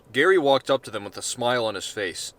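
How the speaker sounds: plain reading voice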